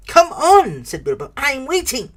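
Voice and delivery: high, loud forced cheer; bold and cheerful. A narrator's reading. Bilbo pushing Gollum to guess, forcing boldness. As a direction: fake confidence over nerves. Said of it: "tried to sound bold and cheerful".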